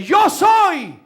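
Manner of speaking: grito fuerte y agudo; proclámalo a voz en cuello; Jesús gritando. Acted. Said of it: "Y él grita". (grito rotundo, con la voz alta)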